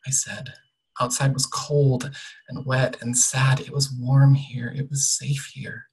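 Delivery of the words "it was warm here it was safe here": ordinary delivery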